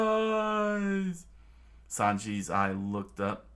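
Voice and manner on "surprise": high shout. Acted. Pearl lands an attack.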